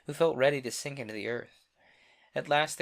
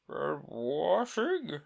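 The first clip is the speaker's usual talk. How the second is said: Mock Turtle voice; 5 semitones higher, 3 dB louder, much slower than usual.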